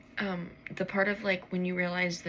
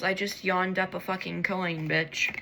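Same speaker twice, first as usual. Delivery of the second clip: ordinary talk, nothing marked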